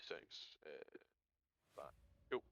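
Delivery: speaking between hiccups; words broken by hiccups. Real, not acted. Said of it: "I am now hiccuping".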